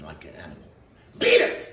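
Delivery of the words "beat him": sharp loud cry of beat him; retold shout. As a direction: shout it like the father. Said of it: "The way my father said it".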